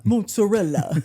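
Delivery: mozzarella in a hammed-up Italian accent; mock Italian accent. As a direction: say mozzarella like a tourist overdoing the Italian pronunciation. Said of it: "people who go to Italy and try to pronounce".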